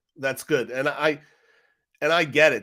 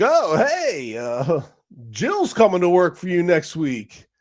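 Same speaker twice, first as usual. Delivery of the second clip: mock congressman; 6 semitones higher, 5 dB louder, slower than usual. Congressman voiced in retold hearing.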